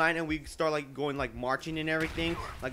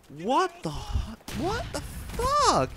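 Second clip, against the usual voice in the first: very high, drawn-out squeal of disbelief; outraged disbelief; 4 dB louder, much slower than usual. Retold or imitated. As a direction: stretch it out slowly, voice shooting up in mock outrage. Student's outraged reaction to punishment.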